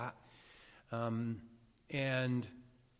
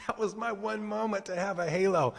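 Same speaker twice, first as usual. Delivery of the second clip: laughing; 9 semitones higher, 4 dB louder than usual. Real, not acted. Laughing retelling after laugh.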